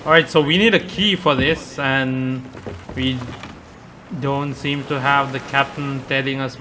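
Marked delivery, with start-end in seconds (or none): none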